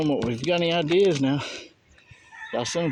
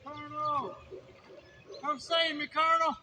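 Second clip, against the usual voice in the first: mock cry for help; 7 dB quieter than usual. Mock calls for help, dog.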